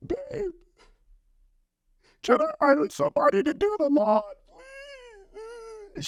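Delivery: high, weepy mock plea to his wife; whiny tearful plea. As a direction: beg like you've just been bawling in the rain. Retelling begging wife after crying.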